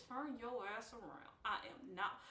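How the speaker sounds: grit your teeth and growl it; through gritted teeth. Retold or imitated. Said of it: "I was talking through my teeth".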